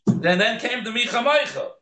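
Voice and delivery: usual speaking voice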